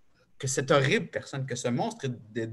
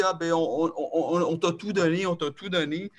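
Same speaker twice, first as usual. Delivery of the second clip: mother pleading; 5 semitones higher than usual. Retold or imitated. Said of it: "elle me dit".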